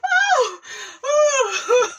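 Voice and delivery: high, loud shrieking laugh; laughing scream. Real, not acted. Cracking up at her own impression, shrieking loud.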